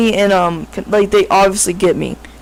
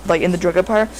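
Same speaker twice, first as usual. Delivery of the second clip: ordinary talk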